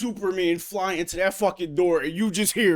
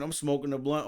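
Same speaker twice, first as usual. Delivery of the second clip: normal talking voice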